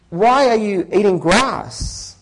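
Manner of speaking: lightly voiced lawyer, asking slowly.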